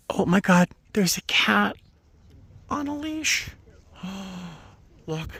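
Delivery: high-pitched thrilled discovery; delighted amazement. Real, not acted. Spotting a cat on a leash, delighted amazement.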